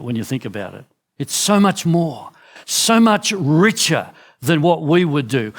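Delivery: ordinary delivery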